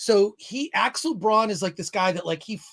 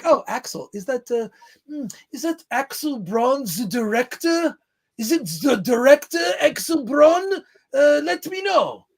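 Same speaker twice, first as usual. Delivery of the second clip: mock pretentious director, higher and louder, with a terrible put-on accent; 6 semitones higher, 4 dB louder than usual. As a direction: ham up a snooty film director asking a question. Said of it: "I'm trying to do a pretentious director".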